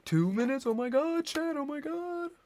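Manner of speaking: high, squeaky disbelief. Real, not acted. Shocked at the timer.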